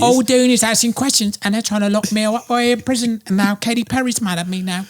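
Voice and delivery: put-on high British accent rant; British accent, pitched well up; Russell Brand impression. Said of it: "Russell Brand going on talk shows".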